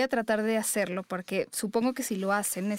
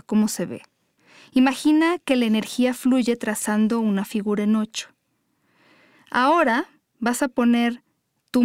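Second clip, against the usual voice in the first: louder, firmer meditation read; guided meditation; 7 dB louder than usual. Acted. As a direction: lead the couple through each step.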